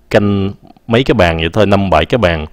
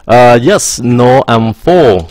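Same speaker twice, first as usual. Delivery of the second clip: slow demo; 4 semitones higher, 5 dB louder, much slower than usual. Shown as a demonstration. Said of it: "demo reply to waiter".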